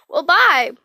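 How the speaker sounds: plain reading voice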